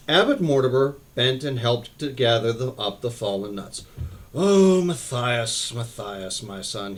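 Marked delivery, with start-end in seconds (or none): 4.3-5.7 slow, drawn-out weary "Oh, Matthias"; weary drawn-out sigh. A narrator's reading. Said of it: "he said wearily". 5.7-6.4 "Matthias" said much softer and lower; weary and softer. A narrator's reading. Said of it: "he said wearily". 6.4-7.0 softer, lower tired voice; weary. A narrator's reading. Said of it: "he said wearily".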